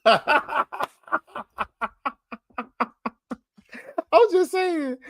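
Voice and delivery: high-pitched, laughed-out protest; cracking up while excusing a jab; laughing. Real, not acted. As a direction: talk through a real laugh. Laughing at own Dooley joke.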